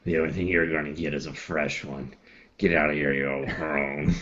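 low, quiet dismissive growl; low gruff voice. Acted. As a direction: brush her off, low and gruff. Character dismisses old woman.

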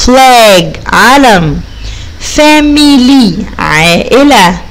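slow, clear model words; slow vocabulary read. Shown as a demonstration. Teacher reading the F words slowly for pupils. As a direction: say each word slowly. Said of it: "عندنا كلمة flag".

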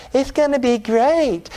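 high, slow, syrupy-positive preacher with a lisp; lisping prophet. Acted. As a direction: put on a drawn-out lisping feel-good voice. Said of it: "have a lisp and everything".

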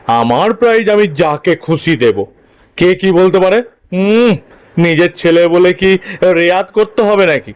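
forceful father's voice, talking his son round; emphatic father waving away every scruple; brazen, persuasive father. Acted. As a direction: argue it like a shameless dad fixing the contest. Father figure in story.

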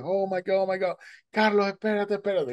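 high, frantic cries; panicked shout. Retold or imitated. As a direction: panic for a friend in the water. Friend panicking on shore.

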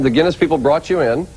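usual speaking voice

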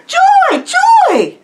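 very high, full-throated shout; loud exultant cry; joyful shouting. Retold or imitated. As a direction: shout it at a complete stranger, elated. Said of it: "he shouted to this complete stranger".

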